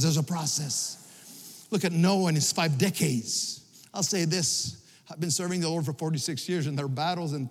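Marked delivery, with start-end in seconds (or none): 0.0-1.7 plain. Shown as a demonstration. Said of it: "Say, say the word with me".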